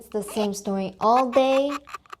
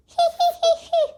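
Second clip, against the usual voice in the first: squeaky high hee-hee giggle; a burst of high tittering; 4 dB louder than usual. Acted. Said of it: "giggled Little Miss Giggles".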